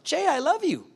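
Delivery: a light take on his wife; wife's reply. Retold or imitated. Wife reassuring husband she loves him, lightly voiced.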